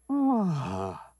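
a short mock sob on ah; brief retold crying sound; imitating the son's sob on one breath; imitated sob. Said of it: "a llorar".

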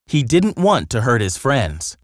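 ordinary delivery

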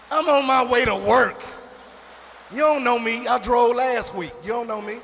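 high, loud indignant rant; defensive rant. Acted. Scrub answering the song's insults, indignant loud rant. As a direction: fire back like an insulted scrub. Acted rebuttal to Scrubs song.

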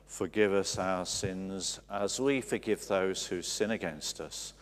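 ordinary talk

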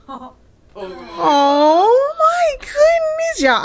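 high, slow cry of emotion, nearly shouted; overcome. Real, not acted. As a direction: gush with real feeling, voice breaking upward. Overwhelmed by the couple's reconciliation.

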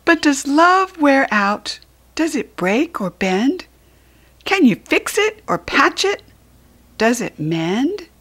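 put-on high, loud pleading child voice; small child asking. Small asking Large about love, a high pleading child voice. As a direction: ask anxiously like a little one.

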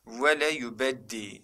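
"Vele yubeddi" slowly and carefully articulated; model reading. Shown as a demonstration. Teacher shows students what the word says, careful model reading. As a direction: sound out "Vele yubeddi" for learners. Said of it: "Hangisiymiş arkadaşlar?".

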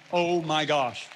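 loud, drawn-in astonished exclamation; retold amazement. Preacher relives getting a huge check, amazed.

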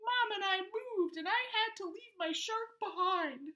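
hammed-up sob story for kids; high childlike voice, lightly sobbing; sobbing remora. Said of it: "she sobbed".

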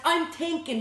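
high, loud snapped retort; irritable growl. A narrator's reading. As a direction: growl it back, annoyed at being asked. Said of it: "he growled".

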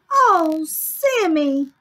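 high, loud group groan. Acted. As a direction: moan it in storybook dismay.

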